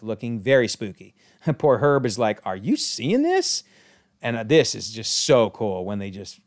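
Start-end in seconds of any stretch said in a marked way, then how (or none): 2.4-3.6 high, slow astonished question; can't believe his eyes; stunned disbelief. Acted. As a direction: neighbour gaping at spooky riders. Herb sees the Salem Seven.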